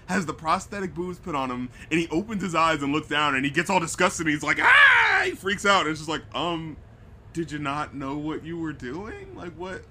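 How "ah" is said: short high shriek of disgust, much louder; mock scream. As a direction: yelp like a hammy actor seeing fake boobs. Retelling character freaking out.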